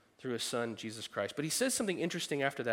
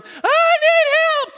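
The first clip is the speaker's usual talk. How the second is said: put-on high, loud, drawn-out child wail; whiny kid plea; 16 dB louder, much slower than usual. Kid crying for help.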